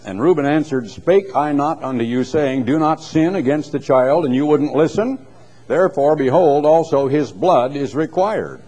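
lifted public reading of scripture. Acted. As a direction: read the King James like from a pulpit. Reads scripture aloud.

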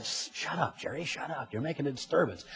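hushed urgent whisper; whispering to a friend in the pew to be quiet. Retold or imitated. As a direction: hiss at him to hush in church. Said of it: "And the guy said".

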